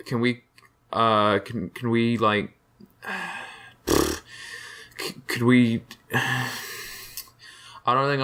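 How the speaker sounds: halting, with heavy sighs and restarts; hesitant complaint. Acted. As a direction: reluctantly work up to an awkward request.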